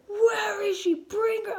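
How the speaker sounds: high, strained yelling; furious demand. Retold or imitated. Mother demanding her child from the baby daddy, furious demand. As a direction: demand her back at a high-pitched shout. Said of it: "turning into the Batman and the Hulk".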